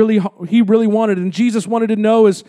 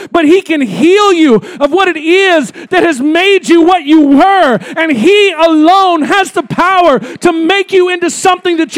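louder, rising sermon push; preaching; 4 semitones higher, 3 dB louder than usual. Acted. Pastor urging the congregation about Jesus' power, building preaching. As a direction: drive the point home to the congregation.

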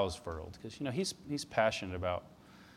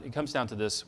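ordinary talk, nothing marked

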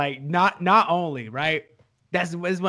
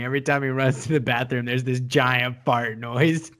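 ordinary talk, nothing marked

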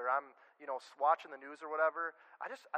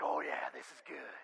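much lower, soft gloating to himself; a low smug murmur while typing; gleeful scheming mutter; 14 semitones lower, 5 dB quieter than usual. Retold or imitated. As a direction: savour the nasty reply under your breath. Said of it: "hitting my keyboard, like".

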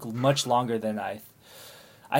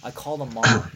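normal talking voice

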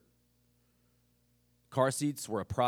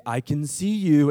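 creepy-sounding call; 4 semitones higher, 12 dB louder, slower than usual. Retold or imitated. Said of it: "a grown man say".

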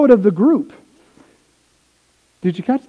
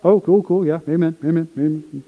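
flat; 4 semitones lower, 3 dB quieter than usual. Pretend. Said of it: "Would it be like".

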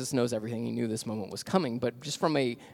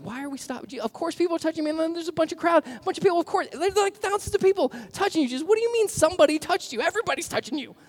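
much higher, loud incredulous disciples; exasperated disciples; 6 dB louder, faster than usual. Retold or imitated. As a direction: squawk it like fed-up followers who can't believe it. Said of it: "I'm sure the disciples are like".

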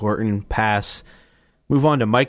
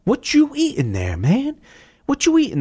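high, goofy coworker voice; exaggerated high buddy voice, overacted; construction buddy. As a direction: ham up a nosy buddy asking about lunch. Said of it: "and his construction buddy's".

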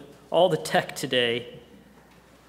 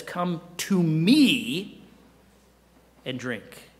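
tender invitation; much slower than usual. Retold or imitated. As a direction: invite them gently into relationship. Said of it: "He says".

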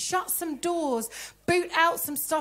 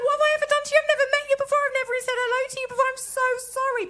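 very high, exaggerated pleading voice; hammed-up hurt protest in high pitch; mock distress; 10 semitones higher, 4 dB louder, much faster than usual. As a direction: squeal a mock apology. Acted reaction to a friend's rage.

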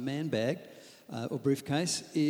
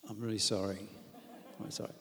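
soft apology; 4 semitones lower, 9 dB quieter, faster than usual. Acted. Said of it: "First response is".

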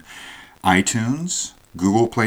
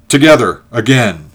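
loud, higher call to join in; rallying call; 5 semitones higher, 13 dB louder than usual. A narrator's reading. Ulrich urging George to shout along, rallying call. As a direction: call the word out, then ease slightly.

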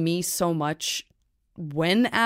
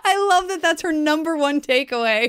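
amused; 9 semitones higher, 3 dB louder than usual. Retold or imitated. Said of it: "I was like".

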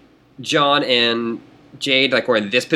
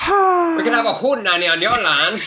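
put-on high, laughing old-timey gent; Conan O'Brien old-timey voice; 11 semitones higher than usual. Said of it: "my Jake voice is just the Conan O'Brien old-timey voice".

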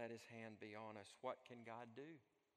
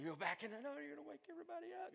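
high, rapid hushed protest; hurried, high-pitched parental objection; urgent warning; 14 semitones higher, much faster than usual. Acted. Acted parent warning against waking house.

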